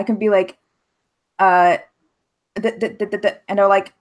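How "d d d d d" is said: stammering on a repeated "D-, d-, d-"; imitated stutter. Nervous French speaker stuck on the phone, stuttering. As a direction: act out getting stuck on the first sound. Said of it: "I have a bad stuttering problem".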